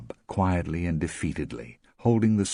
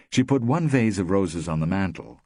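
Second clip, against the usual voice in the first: usual speaking voice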